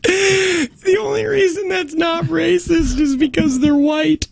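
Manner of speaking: high, slow squeal through laughter; helpless laughter pushing the voice way up; laughing. Real, not acted. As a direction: struggle to get it out while cracking up. Cracking up at the accent bit.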